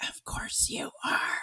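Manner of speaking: soft breathless sarcastic whisper. Retold or imitated. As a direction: mutter it under your breath. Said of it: "I would be like".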